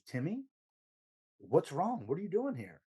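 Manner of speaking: startled, concerned greeting; surprised call. Retold or imitated.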